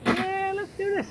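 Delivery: ready-to-fight mock enthusiasm; mock eager. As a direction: hype yourself up for a fight, hammed up. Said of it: "I'd be like".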